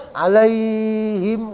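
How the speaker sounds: "Alaihim" with the lai glide held very long; drawn-out vowel. Shown as a demonstration. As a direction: stretch the "ai" in "Alaihim" on a level note. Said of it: "nggak boleh".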